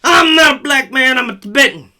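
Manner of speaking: hammed-up crybaby outburst; mock tantrum. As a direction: throw a pretend tantrum. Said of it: "have temper tantrums and shit".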